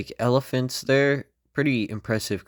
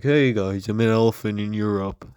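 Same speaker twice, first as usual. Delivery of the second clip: silly elephant voice; 5 dB louder than usual. Pretend. Elephant voiced greeting in Europe.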